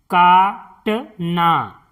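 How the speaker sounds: काटना drawn out slowly as का-ट-ना; slow syllable-by-syllable. Shown as a demonstration. Teacher sounding out काटना for pupils, slowly. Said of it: "इस तरह से".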